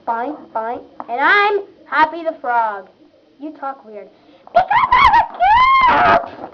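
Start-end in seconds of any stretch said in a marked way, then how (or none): 4.5-6.5 much higher, loud squeal; a high girly shout; squeaky shout. Acted. Character defends weird voice.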